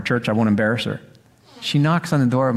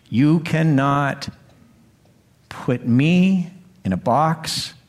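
slow emphatic; much slower than usual. Acted. God speaking, slow emphasis.

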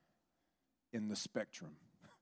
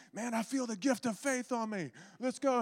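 high-pitched zealous outburst; Chris; 13 semitones higher, 4 dB louder than usual. Retold or imitated. Imitating an eager evangelist in the car, high excited cries. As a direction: cry it out, bursting to get going. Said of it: "And he's just like".

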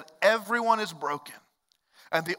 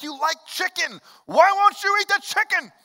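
pleading parent; 11 semitones higher, 5 dB louder than usual. Retold or imitated. Parent coaxing picky child at dinner.

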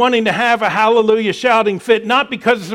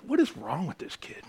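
softer, an incredulous mutter; muttered aside; 2 semitones lower, 11 dB quieter than usual. Retold or imitated. Preacher recalling the kid missing his cue, muttered disbelief. His own thought retold.

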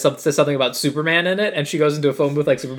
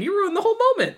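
high-pitched mock-outraged accusation; exasperated scolding; faster than usual. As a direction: scold him with the pitch shooting way up. Scolding the movie character.

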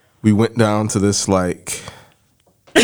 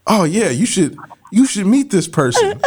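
higher, bright mock enthusiasm; imitating eager friends; 10 semitones higher than usual. Voicing the friends at the table, eagerly pitched up. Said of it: "And they're like".

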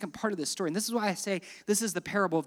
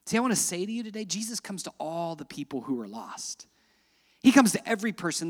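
ordinary delivery

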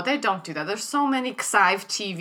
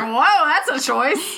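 surprised; 6 semitones higher, 4 dB louder, much slower than usual. Retold or imitated. Retold reaction.

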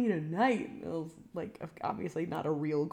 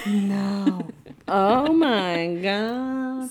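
drawn-out disbelief; 7 dB louder, much slower than usual. Real, not acted. Shocked by cartoon lookalike.

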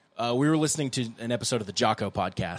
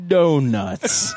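half-speed playback; 4 semitones higher, 9 dB louder than usual. Retold or imitated. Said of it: "on half speed".

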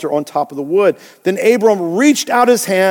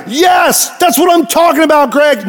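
hammed-up cheer from an eager fan; mock excited churchgoer; 9 semitones higher than usual. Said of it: "Like, like".

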